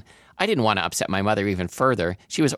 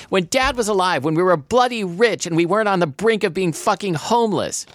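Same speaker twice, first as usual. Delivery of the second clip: much higher, raised-voice fury; screamed tirade; 11 semitones higher, 4 dB louder than usual. A narrator's reading. Daughter raging about being broke, screaming. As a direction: keep the scream going through the rant. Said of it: "I screamed".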